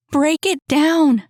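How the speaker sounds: high, loud desperate shout. A narrator's reading. Woman trapped behind the door shouting at Jacob, loud desperate yell. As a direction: yell it through the door at him. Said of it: "She raised her voice".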